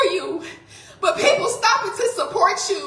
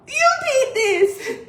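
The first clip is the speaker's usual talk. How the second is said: tearful accusation; 5 semitones higher, slower than usual. Real, not acted. Accusing husband while crying.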